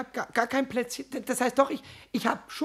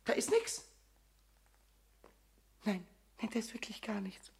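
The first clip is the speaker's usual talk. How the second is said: soft breathy whisper, nearly crying; guilty whisper; 2 semitones lower, 6 dB quieter than usual. Acted. Pumuckl whispering to Eder that nothing is there. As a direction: whisper the lie and hope he buys it.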